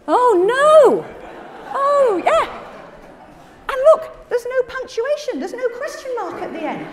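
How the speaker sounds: high, loud wail of mock despair; comic dismay. Real, not acted. Presenter to audience as the slides go wrong, dismayed and high. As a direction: laugh helplessly at the mishap, pitch way up.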